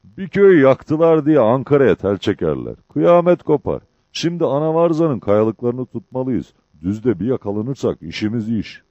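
loud, high-pitched declaration; loud outburst. A narrator's reading. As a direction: burst out loudly as the sergeant. Çavuşun yüksek sesli çıkışı. (yüksek sesle, tiz bir çıkış)

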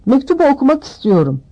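high, fast demanding voice; loud spoilt demand; loud, quick whine for the letter; loudly. A narrator's reading. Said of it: "Yüksek sesle".

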